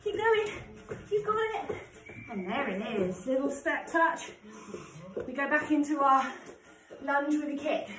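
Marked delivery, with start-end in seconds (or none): 0.0-1.8 encouraging shout. Real, not acted. Coach urging on near interval end.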